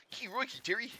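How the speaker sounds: lightly hammed Aussie twang; a bad tourist's Australian hello; awful Australian accent.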